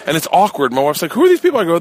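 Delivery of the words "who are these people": high, loud indignant wife; much higher, louder startled wife; shocked wife. Retold or imitated. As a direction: demand it like a wife walking into chaos. Wife confronts strange women.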